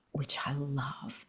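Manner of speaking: a soft, breathy whispered aside. Real, not acted. Sharing what she loves about her grown son, whispered.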